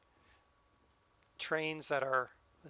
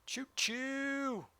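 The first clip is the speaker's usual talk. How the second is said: mock train whistle; 8 semitones higher than usual. Mocking the silent train.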